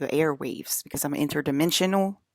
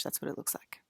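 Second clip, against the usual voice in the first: whisper; 11 dB quieter than usual. Real, not acted. Aside whisper to viewers.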